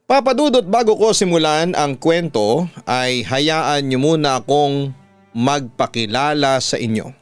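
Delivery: ordinary delivery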